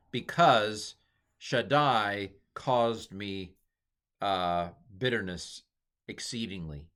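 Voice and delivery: slow deliberate reading. Shown as a demonstration. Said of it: "slow reading of the Hebrew".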